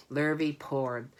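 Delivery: plain reading voice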